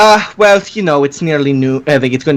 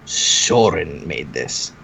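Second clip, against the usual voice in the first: low, very slow, softer; solemn low old-man pronouncement; Thistle; 11 semitones lower, 7 dB quieter, much slower than usual. Acted. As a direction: drop it low and draw out the name. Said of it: "Thistle says".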